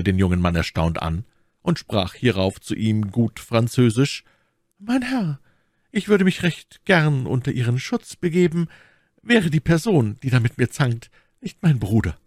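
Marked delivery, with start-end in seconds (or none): 4.8-12.2 high-pitched lady's voice; Milady, composed lady. Acted. Milady answering the young man about her brother, composed. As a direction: start high, ease down politely.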